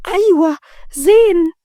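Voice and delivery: light up with glee, stretching the words; joyful. Acted. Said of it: "قالت رغد بفرحة". (بفرحة، بصوت عالي الطبقة وممدود)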